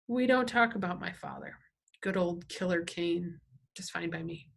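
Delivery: plain reading voice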